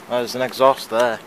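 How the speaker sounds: ordinary talk, nothing marked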